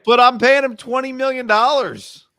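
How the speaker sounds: high, loud put-upon owner voice; mock team owner. Co-host voicing the team that pays him, high and loud. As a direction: play the exasperated boss who pays the bills.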